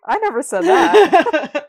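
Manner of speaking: high, loud, slow shriek of protest; playful outrage at full volume; mock outraged shriek. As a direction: drag it out in a scandalised squeal.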